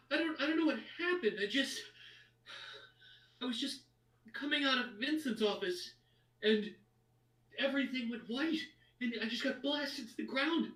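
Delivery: high, fast, rattled noblewoman; shaken noblewoman. Acted. The dazed noblewoman telling the party what happened, high and rattled. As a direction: babble it out, still in shock. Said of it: "she's like".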